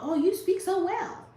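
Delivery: loud, very high gushing voice; exaggerated high-pitched fake praise; mock patronizing compliment. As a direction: gush it in a put-on high voice.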